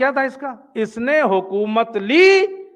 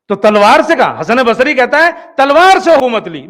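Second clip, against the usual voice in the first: much louder, driving the point home; forceful preaching; 3 semitones higher, 7 dB louder than usual. Real, not acted. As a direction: proclaim it to the whole gathering. Preacher hits the main point. (زور دار خطیبانہ انداز)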